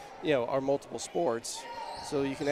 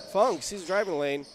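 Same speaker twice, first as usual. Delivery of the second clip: raised-voice basketball commentary; energetic courtside call; live play-by-play; 2 semitones higher, 5 dB louder than usual. Real, not acted. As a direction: follow the play live, punching each move.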